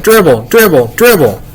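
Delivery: high-pitched, mild holler; childish shout, held back a little; hollering little boy. A narrator's reading. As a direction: call it out like an excited kid. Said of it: "Fudge hollered".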